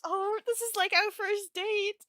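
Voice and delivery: high, slow, gushing gasp; gasping excitement. Acted. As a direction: gush it out, thrilled. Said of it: "He gasps".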